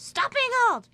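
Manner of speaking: high, loud yell at a husband; loud, high-pitched exasperated shout; mock scream. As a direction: scream it like a fed-up wife. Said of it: "and screams".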